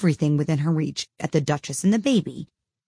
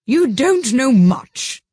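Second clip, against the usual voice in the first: put-on high, slow, gruff old duchess; rough, haughty duchess voice; 8 semitones higher, 7 dB louder, much slower than usual. A narrator's reading. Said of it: "said the Duchess".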